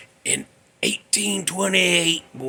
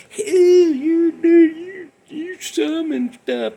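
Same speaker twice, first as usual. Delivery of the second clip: a far higher, slow, whiny accusing voice; mocking townsfolk; 12 semitones higher, 4 dB louder, much slower than usual. Townsfolk accuse him of summoning demons, in a high, slow mocking whine. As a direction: mimic the townsfolk as a nagging, high-pitched complainer. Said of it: "Some of 'em were".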